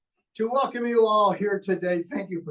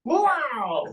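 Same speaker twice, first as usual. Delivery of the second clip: puppet voice; 9 semitones higher, 3 dB louder than usual. Acted. Puppet exclaims in high voice.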